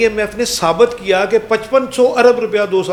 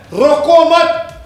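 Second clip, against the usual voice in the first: slow, loud and higher, stretching روکو مت; mocking slogan; 9 semitones higher, 6 dB louder, much slower than usual. Mocking the bureaucrats' motto in a slow, loud voice. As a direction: spell out روکو مت slowly with mock emphasis. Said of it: "ان کا formula ہے".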